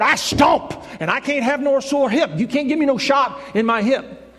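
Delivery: high, punchy preacher's protest; worked-up protesting evangelist. Acted. Evangelist telling doctor why no hip shot, worked-up protest. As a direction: build the refusal in insistent, repeated bursts.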